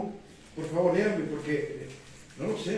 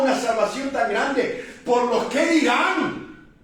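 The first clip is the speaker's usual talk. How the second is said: loud, rising fire-and-brimstone preaching; preaching at full force; 6 semitones higher, 8 dB louder, slower than usual. Real, not acted. Pastor to the congregation about others' opinions, preaching at full force. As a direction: drive it home to the pews, full voice.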